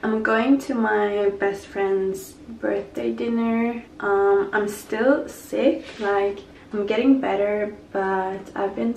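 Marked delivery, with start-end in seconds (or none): none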